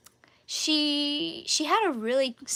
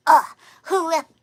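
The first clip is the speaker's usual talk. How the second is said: put-on loud grunt in shaky Russian accent; attempted Russian accent; 6 semitones higher, 9 dB louder than usual. Doctor Einstein falling inside the box, loud attempted Russian accent. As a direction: blurt it in a badly faked Russian accent. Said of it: "I can't do a Russian accent".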